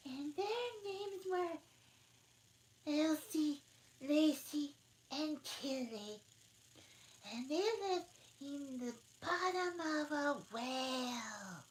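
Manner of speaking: high, slow put-on Dormouse voice; Dormouse in a hurry. Dormouse starting the story, high and slow. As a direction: tell it like a sleepy mouse.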